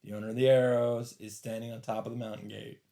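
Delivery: ordinary delivery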